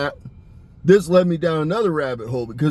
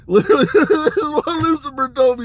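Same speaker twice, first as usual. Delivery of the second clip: laughing; 8 semitones higher than usual. Real, not acted. Cracking up mid-story.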